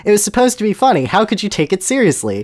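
high, incredulous outburst; exasperated protest. Acted. Voldemort defends his joke to the Reverend, exasperated. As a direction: plead it high, in disbelief.

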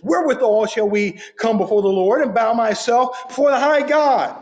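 mock the careless people bargaining with God; flippant. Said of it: "in a very flippant manner".

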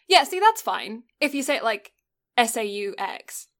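normal talking voice

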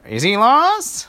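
put-on high, loud, slow old lady question. Old lady fussing at the princesses, high and slow. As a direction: stretch it out, fussy and concerned. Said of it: "the old lady said".

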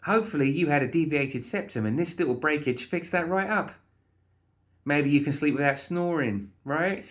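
high, cheerfully chatty voice; chirpy, pushy Molly. Acted. Molly fixing the guard's nose, cheerfully chatty.

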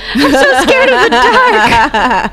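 slow, laughing scared squeak; mock scared voice. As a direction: play a terrified victim, laughing. Mocking movie character.

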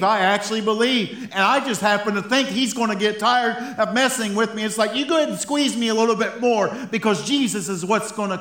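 high, loud, impassioned sermon; fervent preaching. Real, not acted. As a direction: preach it with rising conviction, urging the room on. Preaches at full force.

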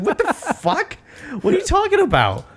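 very high squeaky disbelief; comic falsetto exasperation; mock bafflement. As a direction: squeal it in exaggerated confusion. Mock bafflement at Tommy's question.

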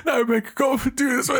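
high cracking mock Funk Flex voice; imitating [name]. Mocking the DJ's cracking voice, pitched high and cracking. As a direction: squeak it out like a cracking hype man. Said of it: "[name] voice just keeps cracking".

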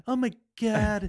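put-on drawn-out Valley girl oh my God. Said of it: "I don't know why I talk like a Valley girl".

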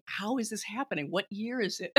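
hushed, incredulous muttering to oneself; quiet disbelief. Retold or imitated. Recalling sitting there appalled at the film, quiet disbelief. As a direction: mutter it softly, as if stunned. Acts out own stunned disbelief.

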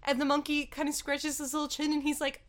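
ordinary talk

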